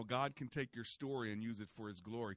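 usual speaking voice

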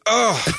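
high, audible cringe groan; loud groan. Shown as a demonstration. Man alone in the shower reliving embarrassment, loud groan. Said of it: "I shout. I go".

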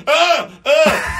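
two high, loud mock groans; imitated cries of pain. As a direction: yelp as if knocked flat by a door. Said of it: "he falls back, and he goes".

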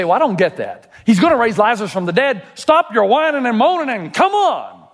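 mock the listener who wants it over quickly; impatient listener. Preacher voicing a doubter urging Jesus on, high and fast. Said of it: "You say".

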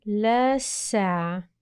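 drawn-out, very slow decoding; slowly puzzling out the word; sounding out. Shown as a demonstration. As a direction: stretch each sound as you piece the word together.

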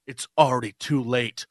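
low, mock-solemn line; mock dramatic ending. Mocking the finale to friends, low and mock-solemn. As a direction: parody a cheesy finale. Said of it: "they're like".

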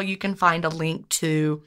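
ordinary talk, nothing marked

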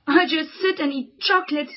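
usual speaking voice

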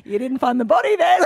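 giggling through a dark joke to the police; blurting it out between laughs; cheeky laughing quip. Retold or imitated. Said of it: "And I go".

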